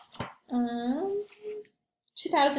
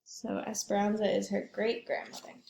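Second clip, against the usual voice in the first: ordinary delivery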